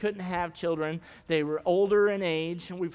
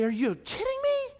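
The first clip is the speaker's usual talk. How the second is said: incredulous. Retold or imitated.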